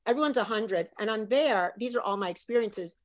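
ordinary talk, nothing marked

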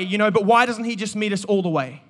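normal talking voice

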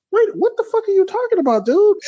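high, fast, baffled mock question; baffled outburst. Recapper voicing the pirate's stunned look, high baffled question. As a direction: act stunned and confused. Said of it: "he gave that look like".

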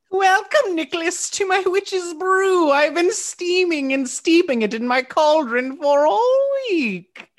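high, slow cackling witch. Acted. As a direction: drawl it like a storybook witch. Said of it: "How long do I have to do this voice?".

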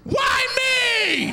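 high, drawn-out comic wail; frantic high-pitched plea; panicked protest. Retold or imitated. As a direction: whine it in helpless disbelief. Panicked protest at delivering baby.

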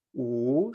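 U stretched in an upward glide; rising tone on U. Shown as a demonstration. Teacher breaks the word apart, U rising.

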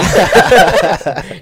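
laughing. Real, not acted. Laughing at own joke.

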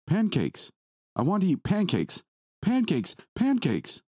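put-on very high, chanting little-boy voice; excited child. A narrator's reading. Son demands his favourite breakfast from dad, excited.

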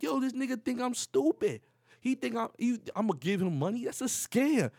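high, loud acted outrage; retold worked-up disbelief; indignant protest. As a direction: protest to your wife that you're being played. Protesting to wife in acted quarrel.

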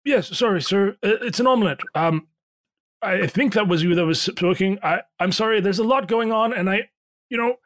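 high, flustered call from the kitchen; flustered innkeeper. Acted. Pierre flustered.

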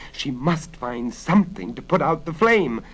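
plain reading voice

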